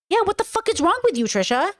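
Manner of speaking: exasperated scolding. Real, not acted. Mocking outrage at Trisha.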